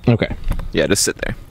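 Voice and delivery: ordinary delivery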